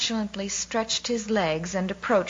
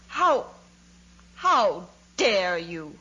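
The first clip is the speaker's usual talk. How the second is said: choked, outraged cry; much slower than usual. A narrator's reading. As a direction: sputter it slowly, choked with indignation. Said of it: "he cried in a choked voice".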